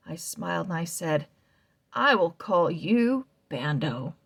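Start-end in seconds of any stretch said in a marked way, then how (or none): none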